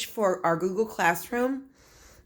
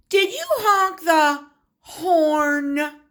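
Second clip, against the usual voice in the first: slow, high, playful ask about honk; playful teacher; playful question; 6 semitones higher, much slower than usual. Shown as a demonstration. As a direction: tease the kids with a slow silly question. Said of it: "teacher playful example".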